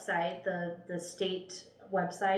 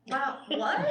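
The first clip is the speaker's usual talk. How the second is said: surprised; 7 semitones higher, 3 dB louder than usual. Retold or imitated. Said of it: "And I was like".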